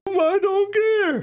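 put-on very high, drawn-out childish wail; upset child. Upset child answering a parent, mock high-pitched wail. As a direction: whine it like a child refusing to listen.

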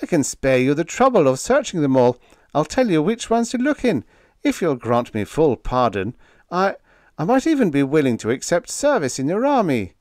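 high-pitched, eager bargaining plea; pleading. A narrator's reading. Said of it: "Biggs pleaded".

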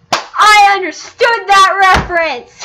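high, loud, slowly stretched exclamation; hammed-up triumph. Player delighted at catching a reference, exaggerated triumphant shout. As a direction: proclaim it with mock-heroic volume.

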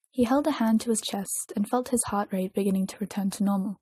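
usual speaking voice

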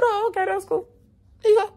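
very high, airy put-on voice; put-on agreeableness. Student handing over phone to respect rules, high put-on agreeableness. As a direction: agree in a very high fake-easy voice. Feigned compliance with rules, retold.

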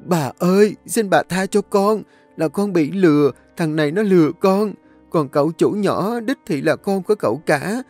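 high, pleading, tearful wail; bursting into tears. A narrator's reading. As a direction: beg for mercy through sobs. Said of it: "nó liền khóc òa lên".

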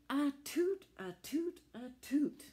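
high, loud sneezing toot; squeaky tugboat sneeze. Acted. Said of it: "sneezed Alice".

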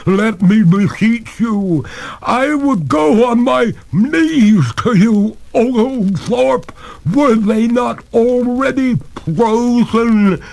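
high, loud, very slow begging; last plea seizing up into a stuttering freeze; freezing ghost. A narrator's reading. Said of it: "even the words froze".

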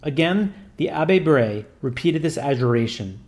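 usual speaking voice

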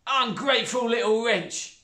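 high, loud stepmother voice; squeamish, careless stepmother; coarse stepmother. A narrator's reading.

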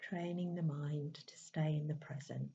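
calm, unhurried meditation voice with long pauses; even, settled delivery in short, spaced phrases; guided meditation. Acted. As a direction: gently guide them inward, leaving space between phrases.

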